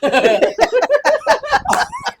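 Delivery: a high, loud rising laugh; loud burst of laughter. Real, not acted. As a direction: laugh out at the silly egg. Laughing at decorated egg.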